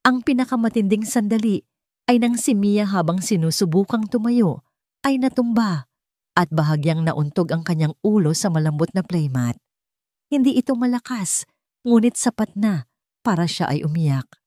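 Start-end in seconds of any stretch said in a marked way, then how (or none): none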